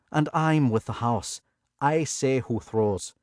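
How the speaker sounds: Irish boss asserting control to Billy; Irish pit boss. A narrator's reading. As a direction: lay down house rules in Irish. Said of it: "a thick Irish accent".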